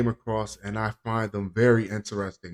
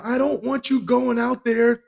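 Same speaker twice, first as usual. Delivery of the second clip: high-pitched put-on wife voice; nagging wife; 11 semitones higher than usual. Wife objecting to husband's outing with Dog, nagging high voice. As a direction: scold him as his wife, voice pitched way up. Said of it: "you can hear his, uh, his wife right now".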